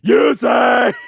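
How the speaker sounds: loud, high-pitched shouted impression; hammed-up dub-parody shout of a name; Jack. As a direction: bellow the name in a mock cartoon voice. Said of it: "I definitely haven't heard Jack's official voice".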